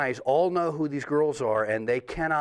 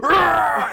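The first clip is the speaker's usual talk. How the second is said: high, loud mock roar; imitated crowd roar; 14 semitones higher, 9 dB louder than usual. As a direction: roar like an angry mob. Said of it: "they were like".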